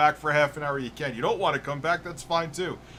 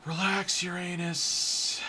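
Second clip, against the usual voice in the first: teasing drawl; 5 semitones higher, slower than usual. Pretend. Joking pun on the planet.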